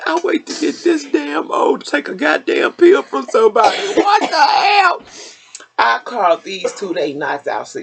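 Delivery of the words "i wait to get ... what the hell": exasperated shout. Retold or imitated. Mother yelling at her son about the pill. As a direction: yell it out, outraged at herself. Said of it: "I said".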